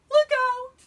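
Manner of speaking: high, loud frightened cry; alarmed warning. A narrator's reading. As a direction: warn in sudden fright. Eddie alarmed in the woods.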